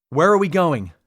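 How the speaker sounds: loud call over the noise. Acted. Baines calling over the buffeting on arrival. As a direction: call it across the bridge. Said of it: "she called over the buffeting".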